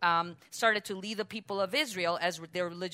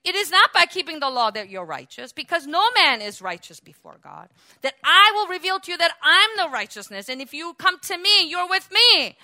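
loud, higher preacher's climax; preaching at full force; 9 semitones higher, 11 dB louder than usual. Acted. Preacher proclaiming Yeshua's righteousness to the church, full force. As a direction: build to a ringing proclamation.

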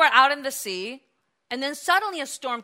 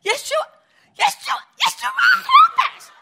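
rising, louder and much higher with each call; desperate cry for help; 10 dB louder than usual. Retold or imitated. Disciples crying to Yeshua in the storm, desperate high pleading. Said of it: "they probably went like".

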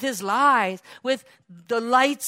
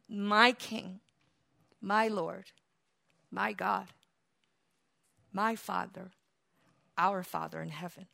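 low, very soft reverent phrases; hushed devotion; 6 semitones lower, 9 dB quieter than usual. Real, not acted. Preacher naming his King and Father, hushed devotion. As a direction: let each title sink into silence.

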